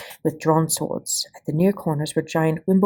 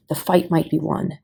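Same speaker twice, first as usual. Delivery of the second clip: plain reading voice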